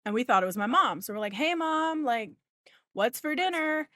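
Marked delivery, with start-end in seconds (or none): 1.3-3.9 high, loud call across the house; a casual shout to mom in the next room. Retold or imitated. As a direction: call out to the front door. Calling out to mom arriving.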